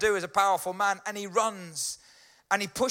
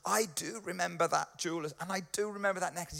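soft, remorseful wife, lightly acted; hushed and moved, a touch of guilt; emotional, feeling bad; 2 semitones lower, 8 dB quieter, faster than usual. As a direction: imitate someone quietly welling up. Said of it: "filling up all emotional, feeling really bad".

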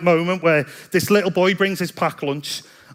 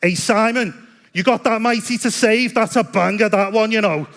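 higher-pitched imitation of a Scouse accent; Scouse lad; 4 semitones higher than usual. Scouse lad shouting up a song request to the worship leader, Scouse impression. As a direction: call out like an eager Scouse lad. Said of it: "the Scouse lad who got baptized goes".

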